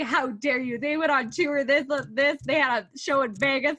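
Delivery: hammed-up offence on behalf of a favourite band; mock outrage. Defending Backstreet Boys to the editor, mock outraged.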